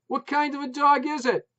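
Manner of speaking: high, loud shout. A narrator's reading. Putz shouting at wife over barking, loud. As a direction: bellow the question.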